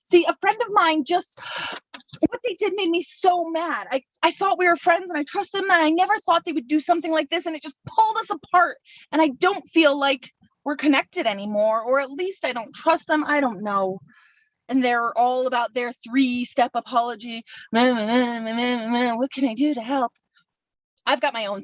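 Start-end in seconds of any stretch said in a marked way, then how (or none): 0.1-14.0 higher, agitated venting as the wronged friend; upset hurt friend. Acted. Wronged friend complaining about a broken trust, upset. 17.7-20.1 dismissive mocking bleats; mock their apology with sneering mehs. Hurt friend mocks the other's three-step apology, dismissively.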